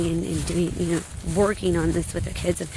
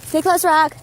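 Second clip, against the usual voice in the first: higher, louder call to the dog; 7 semitones higher, 7 dB louder than usual. Real, not acted. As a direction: call him back to your side. Calling the dog on a hike.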